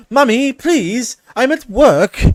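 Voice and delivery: put-on high, slow, whiny British accent; embarrassed son in a high British accent. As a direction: plead in a posh British accent to mummy. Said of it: "I don't know why he's British now".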